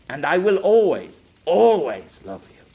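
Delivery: loud, swelling avowal; impassioned love declaration. Acted. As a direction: declare it loudly, stretching the repeated ALWAYS.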